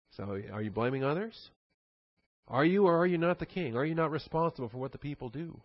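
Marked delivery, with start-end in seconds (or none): none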